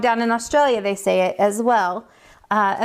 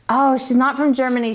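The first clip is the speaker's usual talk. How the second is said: ordinary talk, nothing marked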